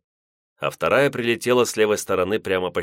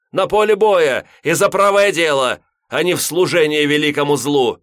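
furious shout; 8 semitones higher, 6 dB louder than usual. Acted. Priest keeps berating the wanderer.

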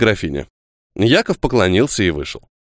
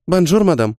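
put-on ringing child voice; 6 semitones higher than usual. A narrator's reading. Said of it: "звонким голоском сказала девочка".

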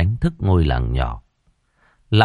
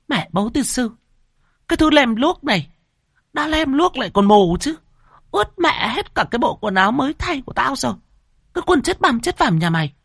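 much higher, fast shrill cursing; faster than usual. A narrator's reading. Drenched mistress cursing the maid, shrill and fast. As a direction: spit curses at the clumsy maid, shrill.

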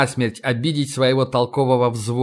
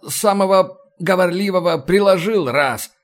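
higher-pitched boatswain telling his side; boatswain's account; 6 semitones higher than usual. A narrator's reading. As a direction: own up to the brawl in a raised-pitch voice.

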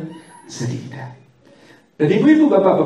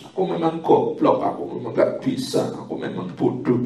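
put-on self-pitying child, dropping to a whisper; a hint of a beaten-down child whispering; mock self-blaming child; 3 semitones lower, 4 dB quieter than usual. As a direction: mutter it like a scolded kid putting himself down.

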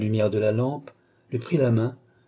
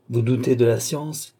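ordinary delivery

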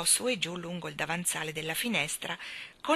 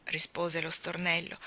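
ordinary delivery, nothing marked

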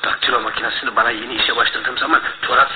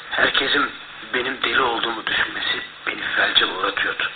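ordinary delivery

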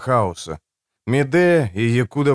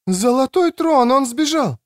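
high-pitched woman's exclamation; alarmed woman; 13 semitones higher than usual. Acted. Woman finding the bed empty, high alarmed cry. Woman sees prisoner has escaped.

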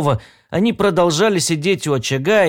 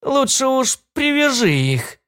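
put-on very high, frail old woman voice; trembling old woman; 10 semitones higher than usual. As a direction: plead like a timid old granny. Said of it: "дрожащим голосом прошамкала старушка".

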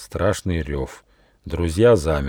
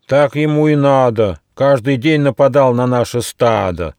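loud, raised, indignant cries; clamouring; 6 semitones higher, 6 dB louder than usual. Acted. Spotted deer jeer at their trapped attacker, clamouring. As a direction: clamour like an aggrieved herd. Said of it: "зашумели пятнистые олени".

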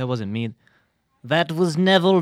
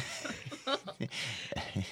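breathy laugh; 6 semitones lower, 15 dB quieter than usual. Real, not acted. Guest laughing mid-interview.

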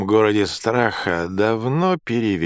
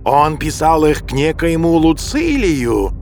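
louder, gasping howl of accusation; breathless howling; 7 semitones higher, 6 dB louder than usual. A narrator's reading. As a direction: wail it out of breath. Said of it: "Он задыхался и вопил".

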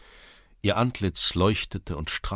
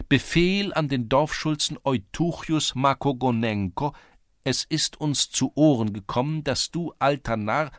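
high, louder official recitation; clerk reading aloud; 8 semitones higher, 4 dB louder than usual. A narrator's reading. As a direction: start the decree over, pitched up. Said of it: "Der Schreiber begann von neuem". (hohe, amtliche Vorlesestimme des Schreibers)

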